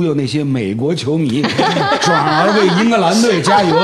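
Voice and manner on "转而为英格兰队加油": laughs out, words still bubbling with laughter; laughing through the punchline; real amused laugh mid-sentence. Real, not acted. Laughing at the promo film.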